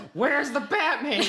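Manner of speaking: high, loud, slow laughing chef yell; Gordon Ramsay shout. Retold or imitated. Imagining Gordon Ramsay screaming for Batman, high and loud. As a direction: belt it like a furious TV chef.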